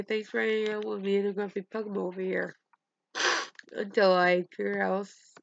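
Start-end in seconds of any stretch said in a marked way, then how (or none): 0.0-2.5 quick sign-off; signature sign-off. Shown as a demonstration. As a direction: rattle off the catchphrase. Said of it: "I'm always gonna say".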